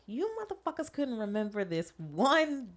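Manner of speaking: slow, high mock-outraged demand; exasperated. Imagined rant at forgetful coworkers, slow and high. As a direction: drag it out high in disbelief. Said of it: "but I'm like".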